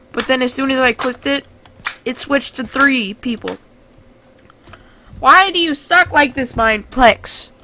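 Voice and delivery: loud, exasperated complaining at the game; frustrated rant. Real, not acted.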